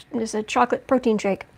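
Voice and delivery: ordinary delivery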